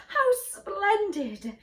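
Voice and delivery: high, loud, slow cry of joy; delighted exclamation. A narrator's reading. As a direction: burst out, thrilled by the news. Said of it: "the queen's face lit up".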